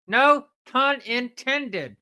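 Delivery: slow repeated quip. Acted.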